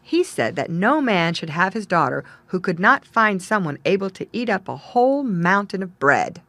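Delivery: usual speaking voice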